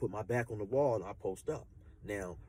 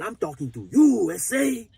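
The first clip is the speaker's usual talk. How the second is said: gangster menace; 9 semitones higher, 10 dB louder, slower than usual. Retold or imitated. Gangster repeating himself to the new inmate, loud and slow. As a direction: lean on every word like a yard bully. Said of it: "the way he got at me".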